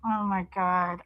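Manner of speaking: embarrassed. Retold or imitated. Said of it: "I turned bright red".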